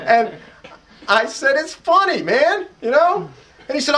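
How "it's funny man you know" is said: amused insistence. Retold or imitated. Speaker insisting the story's funny.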